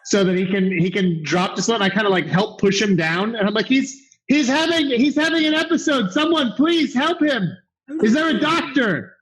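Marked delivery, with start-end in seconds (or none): 3.7-9.1 higher, hammed-up alarm; character pretends his friend collapsed; mock alarm. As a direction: fake urgent call for a doctor. Character calls for a doctor.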